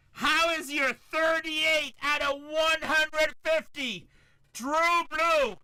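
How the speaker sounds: high, loud, slow hype call; excited hype. Real, not acted. Breaker hyping a rare numbered card to viewers, loud and excited. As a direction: sell the card like it's gold.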